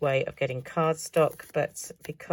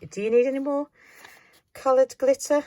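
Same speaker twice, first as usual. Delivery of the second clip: higher, louder mock viewer asking; imitating a skeptical viewer; 10 semitones higher, 8 dB louder than usual. Crafter voicing her viewers' doubt, lightly mocking. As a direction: tease herself as a doubting viewer would. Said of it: "You're probably thinking".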